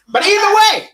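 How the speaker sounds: high, loud insistence; emphatic. Acted. Emphatic wrap-up of the ranking.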